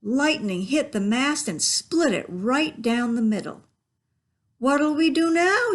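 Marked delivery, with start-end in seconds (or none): 4.6-5.7 high, loud worried shout; panicked pirate yell. A narrator's reading. Pirate yells in panic after mast splits. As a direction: yell it in panic over the storm. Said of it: "yelled one of the pirates".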